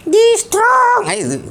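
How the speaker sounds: put-on high, slow child puppet voice; puppet boy voice. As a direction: play the cheeky little boy puppet.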